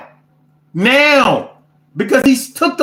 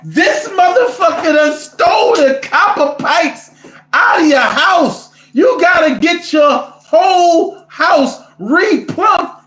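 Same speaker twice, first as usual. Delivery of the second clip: laughing outraged shout; 6 semitones higher, slower than usual. Real, not acted. Ranting to viewers about a stolen house's pipes, laughing shout. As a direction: blow up laughing at the nerve of it.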